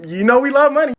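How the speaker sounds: high, loud comic reminder; loud insistence. Retold or imitated. British refused their money, loudly insistent.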